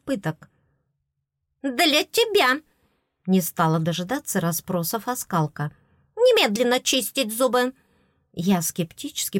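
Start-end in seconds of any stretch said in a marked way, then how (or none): 1.6-2.6 bossy fairy. Acted. Tooth fairy answers, bossy. 6.2-7.7 bossy fairy. Acted. Tooth fairy orders teeth cleaning.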